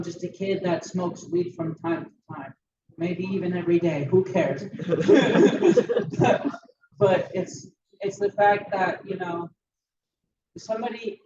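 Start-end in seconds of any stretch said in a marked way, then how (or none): 4.7-6.6 a burst of high loud laughter. Real, not acted. Laughs after "who cares.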